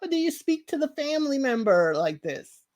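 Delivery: mock outrage at the nurse; a light imitation of an offended relative; indignant. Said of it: "It's like".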